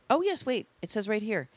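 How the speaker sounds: ordinary delivery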